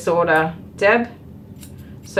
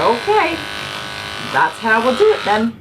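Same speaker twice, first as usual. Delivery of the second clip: mock resigned agreement; 5 semitones higher than usual. Groomer agreeing to client.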